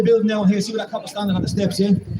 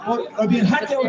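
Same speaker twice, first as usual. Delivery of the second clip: ordinary delivery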